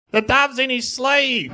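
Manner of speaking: high, slow squawky parrot tattling; slow high parrot voice spilling the secret. Acted. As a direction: play the parrot giving away the trick.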